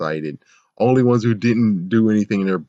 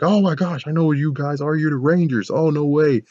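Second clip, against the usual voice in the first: excited Clint impression; 7 semitones higher than usual. Clint reacting to Rangers.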